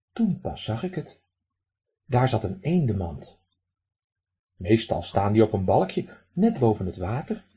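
ordinary delivery, nothing marked

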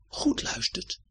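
"goed luistert" breathed as a soft whisper. Shown as a demonstration. Narrator hushing the children to listen, whisper. Repeat then whispered contrast.

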